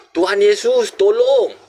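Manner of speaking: high, drawn-out plea for help; calling for help. Retold or imitated. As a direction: re-enact crying out to be saved. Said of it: "saya berseru".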